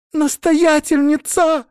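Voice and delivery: high, drawn-out shout; loud, agitated call. A narrator's reading. Novice bursts into the room calling the abbess, agitated. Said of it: "громкий, взволнованный голос".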